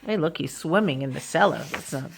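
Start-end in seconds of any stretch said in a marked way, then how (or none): none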